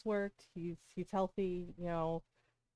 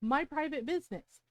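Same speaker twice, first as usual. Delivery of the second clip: higher-pitched, indignant retold telling-off; heated confrontation, retold with raised pitch; retold roasting; 7 semitones higher, 4 dB louder than usual. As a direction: re-enact tearing into someone. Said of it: "And I roasted her".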